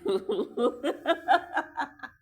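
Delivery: bursting into loud real laughter. Real, not acted. Laughing out loud at her mother's reaction, real loud laugh. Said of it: "I'm still laughing".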